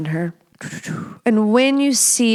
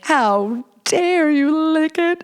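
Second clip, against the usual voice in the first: high, slow, hammed-up indignation; mock outraged wife; 8 semitones higher, slower than usual. Mocking the partner who might object, hammed-up outrage. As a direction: play the shocked wife, drawn out and high. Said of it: "she wouldn't be like".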